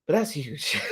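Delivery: normal talking voice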